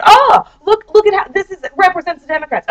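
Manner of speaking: mock the finger-wagging conservative; parroting gloating right-wingers, mocking; mock smug conservative. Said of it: "Everybody that's conservative is like".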